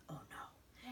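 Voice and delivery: soft whispered dismay; hushed oh no. Real, not acted. Mother reacting softly to fire alarm. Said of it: "fire alarm".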